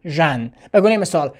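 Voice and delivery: ordinary talk, nothing marked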